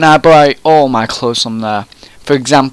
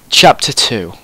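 ordinary delivery

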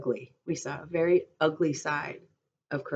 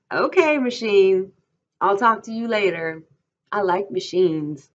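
amused teasing; 5 semitones higher, 7 dB louder than usual. A narrator's reading. Curly's wife humours Lenny's machine story, amused teasing.

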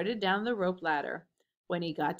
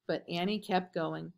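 usual speaking voice

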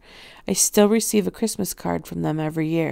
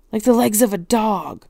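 louder, agitated insistence; urgent exclamation; 3 semitones higher, 5 dB louder than usual. A narrator's reading. Rachel pressing her alarming discovery on the professor, urgent. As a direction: blurt it out emphatically.

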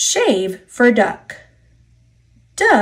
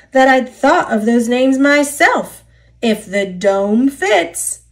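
ordinary delivery

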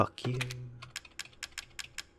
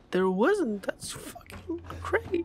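high-pitched, distressed wail; panicked whine; 14 semitones higher, 4 dB louder than usual. Real, not acted. Panicking at no save point.

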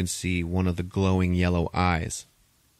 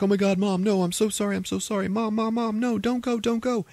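high, pleading cries of sorry; pleading daughter; 14 semitones higher than usual. Retold or imitated. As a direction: beg her mother not to die, pitched high. Daughter begs dying mother.

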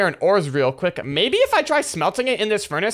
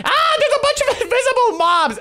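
much higher yelp breaking into a laugh; startled laugh. Real, not acted. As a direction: cry out in surprise, half laughing. Startled by invisible mobs.

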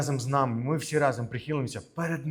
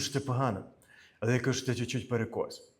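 usual speaking voice

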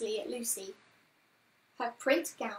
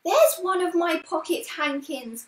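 high, loud exclamation as Lucy; excited cry; 6 semitones higher, 9 dB louder than usual. A narrator's reading. Lucy spotting her own hankie among the washing, excited cry. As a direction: cry out on spotting something of yours. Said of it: "cried Lucy".

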